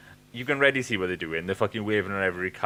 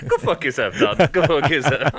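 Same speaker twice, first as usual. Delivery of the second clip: loud, high, laughing jab at a friend; playful laughing shout; laughing mock insult; 6 semitones higher, 11 dB louder than usual. Real, not acted. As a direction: shout it through laughter, not meaning it. Laughing retort to friend's sarcasm.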